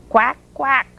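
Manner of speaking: short, punchy duck quacks; lightly voiced duck. A narrator's reading. Mother Duck complains at the truck, light duck quacks. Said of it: "said Mother Duck".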